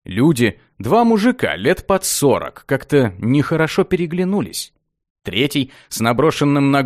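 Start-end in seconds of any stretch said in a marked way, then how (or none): none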